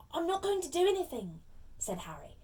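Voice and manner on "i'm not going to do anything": protesting innocence. Acted. Boy protests his innocence.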